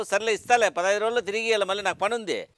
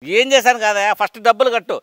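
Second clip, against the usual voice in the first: loud, furious demand for the money; angry demand; 7 dB louder than usual. Acted. Lender furiously demands his money back from the debtor, loud. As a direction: bark at him to pay up first. Quarrel over unpaid loan.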